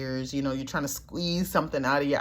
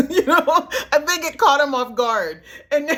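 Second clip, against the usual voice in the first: high, laughing through the words; giggling as she tells it; 12 semitones higher, 6 dB louder than usual. Real, not acted. Laughing at the memory.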